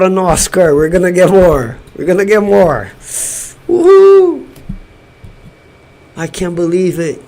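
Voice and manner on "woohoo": high, loud whoop of joy; elated whoop. Real, not acted. Fan celebrating Brazil's Oscar, whooping. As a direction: let out a real victory whoop. Cheering a live Oscar win.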